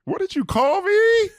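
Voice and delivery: high, shouted comic indignation; mock outrage. Host feigning offense at a misheard name, in shrill outrage. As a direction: shriek it as if mortally offended. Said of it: "you gave it a new reading".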